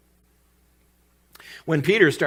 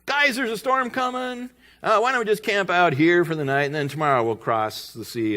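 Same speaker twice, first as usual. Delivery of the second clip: lightly acted, higher-pitched proposal; imagined suggestion; 7 semitones higher, 3 dB louder than usual. Jesus proposing to camp before the storm, lightly acted. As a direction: pitch it up like a casual group suggestion. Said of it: "he could have said".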